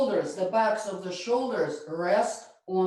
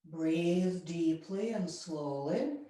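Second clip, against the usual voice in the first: guided relaxation; 4 semitones lower, 6 dB quieter than usual. Acted. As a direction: ease the class into stillness. Yoga teacher guides relaxation.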